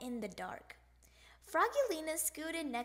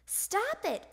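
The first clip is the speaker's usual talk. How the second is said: high, raised order to stop; scolding cry; 8 semitones higher, 4 dB louder than usual. A narrator's reading. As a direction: cry out, fed up with the mess. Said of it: "cried Dad".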